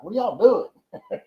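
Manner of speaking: high, slow, laughing disbelief; surprised laughing question. Retold or imitated. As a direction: drag it out, amused and baffled. Coming home to camera crowd.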